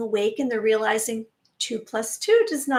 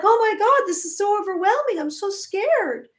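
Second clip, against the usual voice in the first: high, put-on frightened whine; imitating an overwhelmed, scared person; mock panicked voice; 9 semitones higher than usual. To the listeners, mock-scared whine of people overwhelmed by fear news. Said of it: "we're kind of like".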